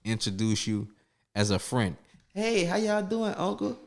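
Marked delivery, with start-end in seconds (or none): 2.3-3.8 high, slow sing-song introductions; lightly airy fake-friendly intro voice; mock partner at family gathering. Mocking a partner introducing him to the family, high and slow.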